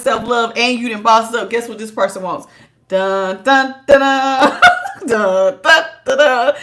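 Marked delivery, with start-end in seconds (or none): 2.9-6.5 dramatic dun dun dun. Pretend. Dramatic sound effect before reveal.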